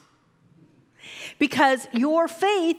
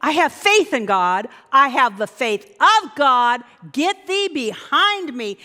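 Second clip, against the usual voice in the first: bold declaration; slower than usual. Shown as a demonstration. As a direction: claim it boldly. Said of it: "that's when you say".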